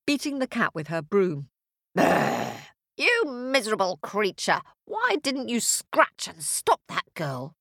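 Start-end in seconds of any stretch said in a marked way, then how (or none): 1.9-2.6 low rolled growl of fury; angry witch's brr. Acted. Witch roaring in rage. 3.0-7.6 cross, higher-pitched telling-off; scolding witch. Acted.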